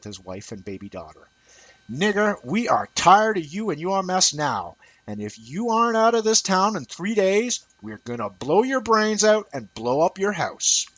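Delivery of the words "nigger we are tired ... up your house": menacing caller. Acted. Anonymous caller threatens King.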